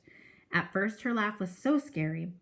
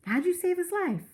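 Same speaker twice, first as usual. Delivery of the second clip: put-on a higher, boyish voice asking eagerly; curious kid voice; 11 semitones higher than usual. Kid asking the old man how he saved the dog, curious boyish voice. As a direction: play the wide-eyed kid brother. Byron asks, voiced as boy.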